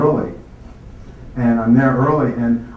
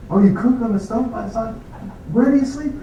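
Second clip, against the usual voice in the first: much higher, fascinated mock-dean voice; imitating the dean; 10 semitones higher than usual. Dean quizzing a student who walks everywhere, high amazed voice. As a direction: play the dean as a wide-eyed, high-pitched questioner. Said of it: "And says, uh".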